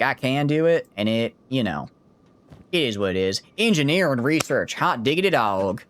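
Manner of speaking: hammed-up Southern accent, lively and lilting; exaggerated Southern accent. Shown as a demonstration. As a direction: overdo the Southern accent for a laugh. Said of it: "that was a bit much".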